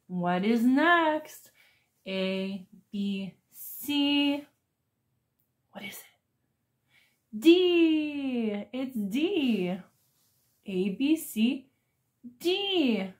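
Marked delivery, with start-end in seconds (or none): 5.7-7.4 whisper. Acted. Teacher whispers question to kids.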